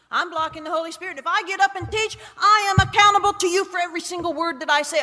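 high, rushed, rising argument; retold frantic objection; agitated protest. Arguing with God in retelling.

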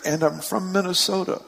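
light retold call. Preacher recalls the little thing, quoting lightly. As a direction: quote the yell lightly, without shouting. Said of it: "suddenly it's just a little thing".

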